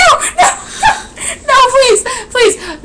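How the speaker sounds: high, loud wailing plea, laughing through it; hammed-up desperate begging; mock pleading. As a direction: beg dramatically, half laughing half crying. Begging not to marry him.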